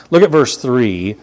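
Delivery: normal talking voice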